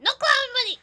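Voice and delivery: loud screechy cartoon outburst; Lemongrab impression. Shown as a demonstration. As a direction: shriek it like an unhinged cartoon lemon man. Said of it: "crazy lemon grab impression".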